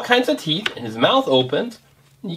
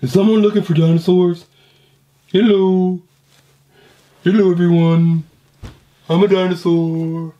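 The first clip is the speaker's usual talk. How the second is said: playful dinosaur voice; 2 semitones higher than usual. Pretend. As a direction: boom out a cheery dinosaur greeting. Parent voices a second dinosaur toy.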